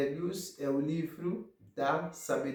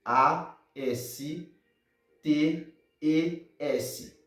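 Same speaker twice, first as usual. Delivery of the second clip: slow letter-by-letter spelling for students; much slower than usual. Shown as a demonstration. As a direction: spell each letter slowly and clearly. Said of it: "vou soletrar".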